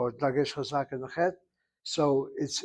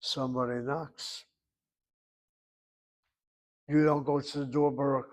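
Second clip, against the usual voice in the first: ordinary delivery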